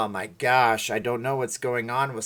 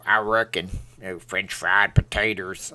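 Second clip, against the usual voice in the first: Sling Blade; 5 semitones lower than usual. Acted. Streamer answering a request for viewers, low Sling Blade impression. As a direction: lower your voice into a rural Southern character. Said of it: "That's just Sling Blade".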